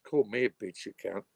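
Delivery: normal talking voice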